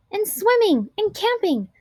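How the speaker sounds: put-on teacher cheering up the children, lifted; happy list. A narrator's reading. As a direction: sing out each treat brightly. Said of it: "said Missus McBee".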